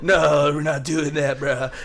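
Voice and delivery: loud, high Cali bro voice; mock California bro; exaggerated Californian. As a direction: push the Californian slang hard. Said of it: "that's how this motherfucker talks".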